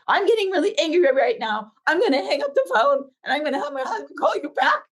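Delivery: retold anger, pitched high; going off angrily. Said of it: "I went off".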